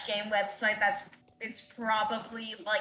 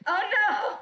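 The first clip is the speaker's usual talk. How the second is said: surprised cry; 11 semitones higher, 5 dB louder than usual. Real, not acted. Character dies in game.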